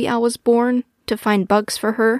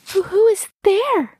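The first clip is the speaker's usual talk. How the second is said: high, hesitant small voice; timid; 12 semitones higher than usual. A narrator's reading. Baby owl hearing scurrying in the darkness, timid. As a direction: call out timidly into the dark. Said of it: "she asked timidly".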